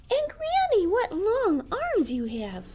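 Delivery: put-on high, slow, amazed girl; wondering little girl.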